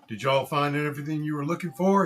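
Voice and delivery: high, loud friendly clerk voice; overly bright customer-service chirp; cheery shop clerk. Acted. Code Talker plays shop clerk.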